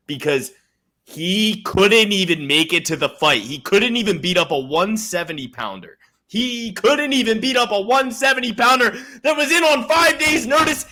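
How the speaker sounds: rising into a high shouting rant; climbing outrage; heated rant. Real, not acted. Ranting about Chimaev missing fight.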